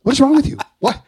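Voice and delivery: high, loud incredulous cry; half-laughing mock alarm from mom and dad; alarmed parents. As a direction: shout it like worried parents. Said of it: "And they're like".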